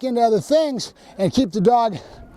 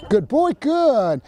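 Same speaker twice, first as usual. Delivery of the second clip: higher, slow praise to a dog; drawn-out dog praise; 6 semitones higher, much slower than usual. Real, not acted. Trainer rewarding Loki for responding, high drawn-out praise. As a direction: stretch out the praise, pitched up.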